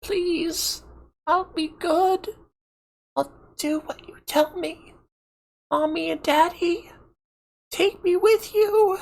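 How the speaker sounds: put-on very high small child's voice, begging; a little girl begging not to be left; pleading little girl. A narrator's reading. Abandoned little girl begs her parents, high pleading child voice.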